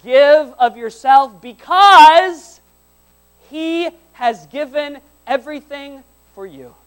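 high, loud and drawn-out preacher's climax; impassioned preaching. Real, not acted. Preacher urging the congregation to give, impassioned slow shout. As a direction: stretch the words out at full voice.

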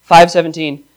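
ordinary talk

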